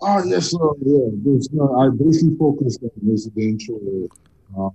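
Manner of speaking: muffled phone line, as if covered. Real, not acted. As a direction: speak through a hand over the mouth. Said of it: "the line has gone all muffled".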